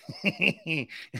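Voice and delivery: helpless giggle tapering off; real laughing fit. Real, not acted. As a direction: laugh without quite knowing why. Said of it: "I don't know why I'm laughing".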